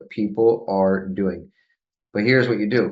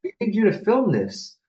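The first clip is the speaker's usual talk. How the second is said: imitated eager urging; 10 semitones higher than usual. Retold artists urging him to join.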